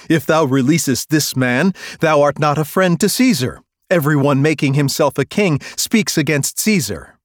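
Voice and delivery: loud menacing cry; crowd threatening. Acted. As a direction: threaten the governor as the mob.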